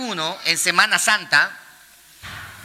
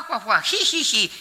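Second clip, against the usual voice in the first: mock giggling; 2 semitones higher, 3 dB quieter than usual. Imitates laughing at communion.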